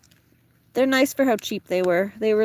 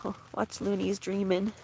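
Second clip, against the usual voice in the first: ordinary talk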